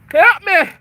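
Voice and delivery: hammed-up loud, high plea for help; mock cry for help. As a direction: yell it like a fake damsel in distress.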